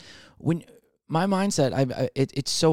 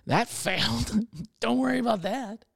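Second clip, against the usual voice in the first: high-pitched, laughing admission; 8 semitones higher than usual. Real, not acted. Comic admitting his design plan failed, laughing it off. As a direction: break into a laugh and wave it away.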